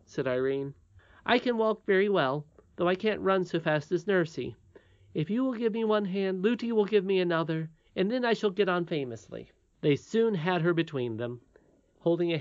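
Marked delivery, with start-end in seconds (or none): 1.3-9.5 put-on higher-pitched child, self-assured; polite little princess. Princess declining to be carried, politely self-assured. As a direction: play a capable little girl.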